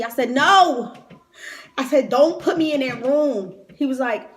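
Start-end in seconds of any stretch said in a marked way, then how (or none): 0.3-0.9 sharp loud shout of no; loud refusal. Retold or imitated. Refusing to enter the room. 2.1-3.5 loud plea. Retold or imitated. Pleading at hospital, retold.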